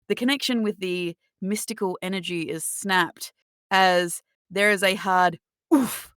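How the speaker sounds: dramatic, measured scene-setting; game master narration. Acted. Game master describing a tackle to the players, dramatic narration. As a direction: narrate the blow-by-blow deliberately.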